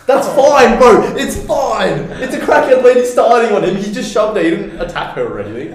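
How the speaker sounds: retelling the bystander's excuse, laughing hard; laughing mock defence. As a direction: plead innocence while cracking up. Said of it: "And he was".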